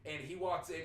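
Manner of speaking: ordinary delivery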